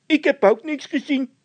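very high, loud scared voice; a nervous squeaky henchman; squeaky frightened De Wit. A narrator's reading. (De Wit, piepend hoog en bang)

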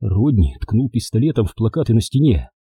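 ordinary delivery, nothing marked

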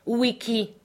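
high-pitched, insistent challenge; sharp demand. A narrator's reading. Scott pressing Beauty Smith for respect, demanding. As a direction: demand the missing title.

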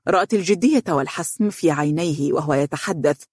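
ordinary delivery, nothing marked

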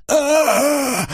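drawn-out strangled "aaah"; strangled scream. A narrator's reading. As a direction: cry out as if grabbed by the throat. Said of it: "сдавленно заорал я".

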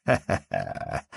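low, chuckled ha-ha-ha; father's acted laugh. A narrator's reading. Father amused by his little son's answer, acted laugh.

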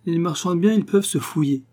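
brash, self-assured retort to the table; cocky retort. A narrator's reading. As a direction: toss it off boldly, a tipsy young man showing off. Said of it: "pour affirmer crânement".

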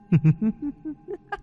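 high, soft eerie ghost laugh; ghostly cackle. A narrator's reading. Ghost laughs at Lý, high eerie cackle. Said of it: "những tiếng khóc cười xáo trộn".

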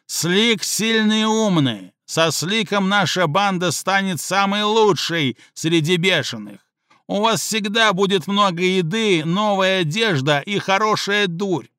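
Слик boasting to the gang, swaggering; boastful gang leader. Acted. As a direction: sell yourself to the gang. Слик's boastful speech to gang.

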